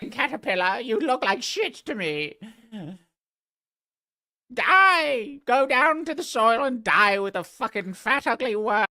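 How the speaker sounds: high, loud, squeaky creature rant; a sneering butterfly screeching at a worm; shrill butterfly voice. Acted. Butterfly screeching insults down at a fat caterpillar.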